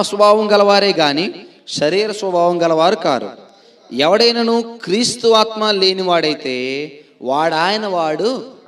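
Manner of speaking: loud, slow declamatory Bible reading; scripture reading. Acted. Preacher reads scripture to the congregation, loud, solemn declamation. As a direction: proclaim the verse phrase by phrase, drawn out.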